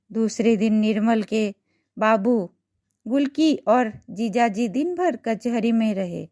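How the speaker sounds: usual speaking voice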